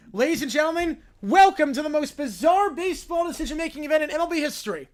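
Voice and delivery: loud, high announcer call; ring announcer. Acted. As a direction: announce it to the whole arena. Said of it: "[name] is announcing this".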